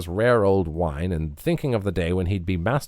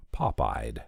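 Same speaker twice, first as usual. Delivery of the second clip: plain reading voice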